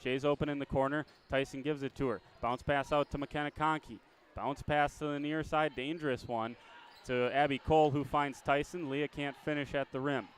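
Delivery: brisk live game call, rising with the action; play-by-play. Acted.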